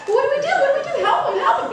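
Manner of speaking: very high, squealing panic; mock panic. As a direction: cry out helplessly in hammed-up alarm. Acted panic over the monkey.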